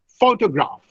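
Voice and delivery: "photograph" with PHO- prominent; stress on first syllable. Shown as a demonstration. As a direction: say "photograph" as PHO-to-graph, falling after PHO. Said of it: "The stress is on the first syllable".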